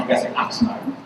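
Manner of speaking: Oxnard said as taught, American; perfect Oxnard. Shown as a demonstration. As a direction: whisper Oxnard the careful way. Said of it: "you have to say perfect".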